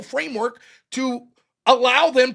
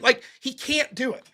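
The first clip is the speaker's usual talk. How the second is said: normal talking voice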